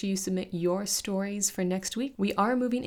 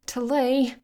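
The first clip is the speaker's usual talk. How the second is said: urgent rasp; 6 semitones higher, 6 dB louder than usual. Acted. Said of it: "Shally rasped in Talay's ear".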